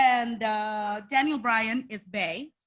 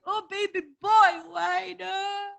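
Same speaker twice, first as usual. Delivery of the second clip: put-on shouted, higher wailing to a baby boy; mock wail; 7 semitones higher, slower than usual. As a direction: cry out like a heartbroken mother. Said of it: "And I was like".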